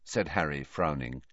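ordinary delivery

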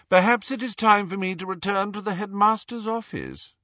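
high, lofty portrait voice; haughty announcement. A narrator's reading. Portrait tired of contradiction announcing departure, haughty. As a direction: announce your departure with affronted pomp. Weary portrait threatens to leave.

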